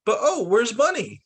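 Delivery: worried cry. Acted. Child misses her bunny.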